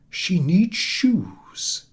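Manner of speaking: lightly voiced old man, concerned; slow concerned remark. A narrator's reading. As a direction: linger on it, worried for the baby. Cobbler notices cold feet.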